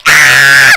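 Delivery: loud sudden scream "Ah!"; short scream. Retold or imitated. Venting alone in the car after work, loud scream. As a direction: let out a loud yell. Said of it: "I'd scream".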